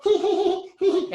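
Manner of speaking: high squeaky mock giggle; girly giggle. Girls giggling at nervous Eric, high mock titter. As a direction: imitate two girls tittering at a boy.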